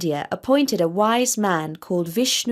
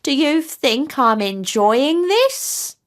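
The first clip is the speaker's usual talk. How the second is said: higher, slow indignant tortoise; slow, higher exasperated old tortoise; irritated tortoise; 5 semitones higher, 3 dB louder, slower than usual. A narrator's reading. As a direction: let the fed-up creature retort. Tortoise snaps at the geese.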